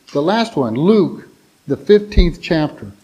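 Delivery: ordinary delivery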